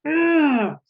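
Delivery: high, loud pushed-out "ugh" in a strained voice; angry growl. Shown as a demonstration. Teacher acting out bodily anger: strained growl. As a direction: growl it from the jaw, fists clenched. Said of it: "that's where anger tends to be".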